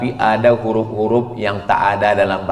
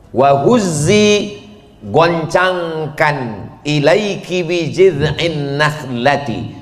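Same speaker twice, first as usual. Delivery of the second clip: slow, measured verse with gloss; slow explaining recitation; 4 semitones higher, slower than usual. Acted. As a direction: read it out slowly, explaining. Recite then translate.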